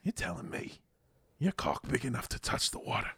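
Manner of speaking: hushed, incredulous British impression; near-whispered British disbelief, imitated; British accent. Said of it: "he paused for a second".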